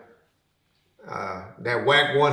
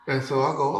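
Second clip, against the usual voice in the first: usual speaking voice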